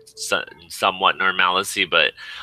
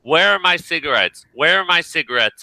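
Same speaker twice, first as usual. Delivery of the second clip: imitating his demanding mother; 8 semitones higher, 3 dB louder than usual. Mother barging in demanding cigarettes.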